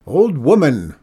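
loud, swooping hail; calling down. A narrator's reading. Old man on the ledge to woman below, calling down. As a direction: holler to someone far below. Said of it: "the man called down".

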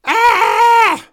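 high, loud "Ah!" scream. A narrator's reading. Narrator screams on finding a creature in the closet, high loud scream. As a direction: let out a piercing held shriek. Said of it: "Then screamed again".

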